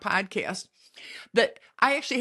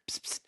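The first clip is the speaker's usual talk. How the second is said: whispered psst; 12 dB quieter than usual. Pretend. Prompting listener to whisper age.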